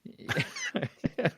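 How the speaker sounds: sudden high-pitched laugh; amused squealing laugh. Real, not acted. Laughs at friend's answer.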